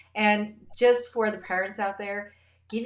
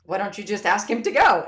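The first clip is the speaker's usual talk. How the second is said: louder, mock-annoyed question; mock exasperated wife; 8 dB louder than usual. Wife imagined scolding husband, mock exasperated. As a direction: needle him like an irritated spouse. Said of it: "calling me out or".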